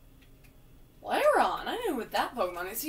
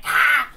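monster roar; 4 semitones higher, 15 dB louder than usual. Pretend. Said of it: "He's like".

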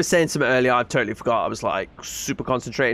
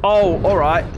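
startled; 4 semitones higher, 6 dB louder, slower than usual. Real, not acted. Dogs chasing the player.

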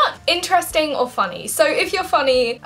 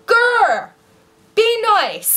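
high, loud playful growl of reproach; mock scolding; 8 semitones higher, 4 dB louder, much slower than usual. Vlogger telling off someone self-obsessed, mock scolding. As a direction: scold in big, punchy bursts, hammed up.

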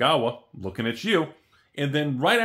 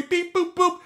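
high-pitched beep boop noises; robot beeps; 6 dB louder than usual. Pretend. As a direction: chirp it like a little robot.

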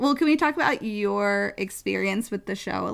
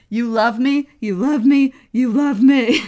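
building louder and higher each repeat; dramatic love declaration; 3 semitones higher, 8 dB louder than usual. Retold or imitated. Hal pleading with Madge at the train, building louder. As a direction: swell into a hammed-up dramatic plea. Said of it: "so dramatic".

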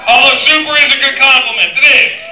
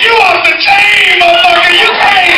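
excited hype shout; 8 semitones higher, 8 dB louder than usual. Retold or imitated. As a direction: shout it like a fan hyped in the parking lot. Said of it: "be like".

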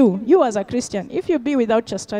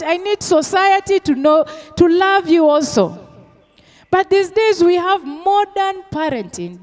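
preaching at full force; 7 semitones higher than usual. Acted. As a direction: preach it hard to the congregation. Preacher raising voice on discipline.

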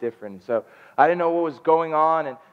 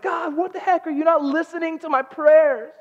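high-pitched cry, building louder; exasperated outcry; 5 dB louder than usual. Retold or imitated. Husband to God as lungs never come, exasperated outcry. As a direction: demand an answer from God, exasperated.

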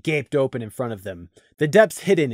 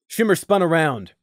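ordinary delivery

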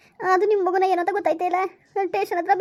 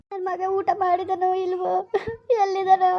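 crying; 3 semitones higher than usual. Acted. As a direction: break down wailing. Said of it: "please ಆಯ್ತಾ ... ಧೈರ್ಯ ತಂದ್ಕೊಳ್ಳಿ". (ಜೋರಾಗಿ ಅಳುತ್ತಾ ಗೋಳಾಡುವುದು)

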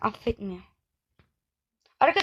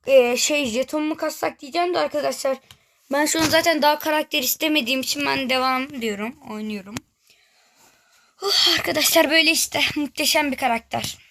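normal talking voice

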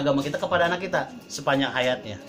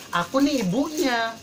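high-pitched mock mother insisting; possessive mother; 9 semitones higher than usual. Mother claiming her right to teach her married son, possessive. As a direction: imitate a mother asserting her rights, much higher. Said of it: "tidak sedikit orang tua kan".

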